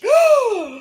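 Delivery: real startled gasp; sharp gasp. Real, not acted. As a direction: gasp as the news lands. Reacting to breaking news.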